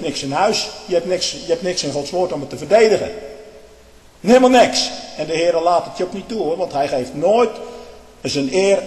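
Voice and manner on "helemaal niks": loud scornful outburst. Real, not acted. Preacher hammering his point.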